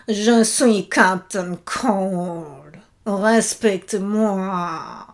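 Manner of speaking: low, very slow, menacing villain voice; Captain Cold impression. Mocking the actor's delivery for her viewers, a low, slow Captain Cold voice. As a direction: drag every word out like a cold, self-important villain. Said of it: "Parce qu'il parle comme ça".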